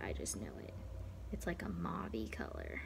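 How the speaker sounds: soft, voiceless whisper. Real, not acted. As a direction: whisper it close and low. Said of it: "sorry for the whisper voice".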